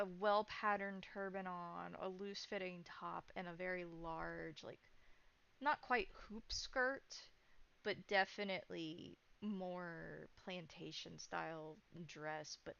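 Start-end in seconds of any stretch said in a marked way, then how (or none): none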